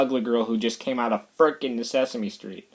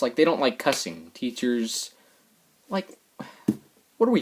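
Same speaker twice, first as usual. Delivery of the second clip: ordinary talk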